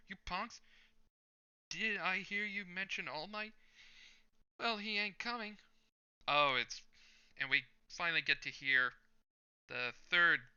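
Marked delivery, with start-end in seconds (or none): 0.1-5.6 much higher, thin hero voice; a squeaky, too-high take on the hero; high-pitched first try at the hero's entrance. Acted. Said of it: "I wanted to do this in a certain way".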